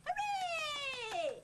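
high, long-held cheer. Acted. Spectator greeting the curtain going up, cheering. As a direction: cheer in a high drawn-out whoop.

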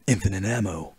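low, quiet game-hero voice; soft, low tough-guy line; Snake. Acted. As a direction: drop it deep and hushed like a soldier. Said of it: "my Snake voice is not as good".

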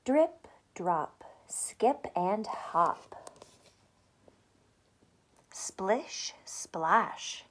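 a hushed read-aloud whisper. A narrator's reading. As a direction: breathe the words like gentle rain. Whispered rhyme reading.

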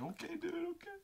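soft, very high falsetto; small, high, comic appeasing voice; mock placating. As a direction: squeak a timid retreat. Placating a raging brother.

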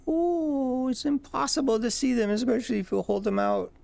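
high playful squeak; squeaky playful voice. Pretend.

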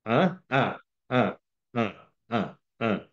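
imitated monosyllabic husband on the phone; curt grunting. Husband answering his wife's office call, mock curt grunts. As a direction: grunt each reply as if she's interrupting you. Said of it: "it's a two-word conversation".

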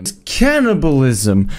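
sudden loud outburst; shouting. Real, not acted. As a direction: blurt it out at full volume.

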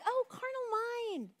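mock-gentle shush to the carnal mind; hushing the flesh. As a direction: soothe and silence it playfully. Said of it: "And then I'm like".

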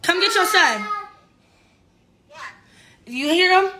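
usual speaking voice

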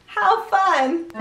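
usual speaking voice

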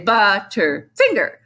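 slow "Butterfinger" in separated syllables; wrongly broken-up word. Shown as a demonstration. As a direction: chop "Butterfinger" into stiff, unnatural parts. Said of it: "You're not gonna say".

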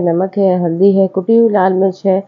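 ordinary talk

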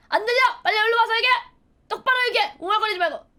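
high, loud, impatient orders; scolding mom. Retold or imitated. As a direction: imitate a mother yelling at a mumbling kid. 엄마가 다그치는 장면. (높고 크게 호통치는 엄마 흉내)

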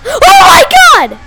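very high, loud shriek; frightened scream. Real, not acted. Player startled by an ugly monster, frightened scream. Jump scare.

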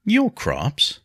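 high, puzzled echo; surprised question. A narrator's reading. Nobleman questioning a woman's claim to crops, surprised. Henry baffled by her claim.

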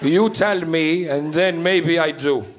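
put-on slow, deliberate accented father; immigrant dad. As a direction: imitate Dad cautiously weighing it up, word by word. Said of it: "He's like".

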